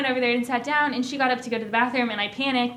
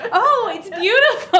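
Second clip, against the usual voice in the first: laughing gush; 8 semitones higher, 6 dB louder than usual. Retold or imitated. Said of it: "retold complimenting, laughing".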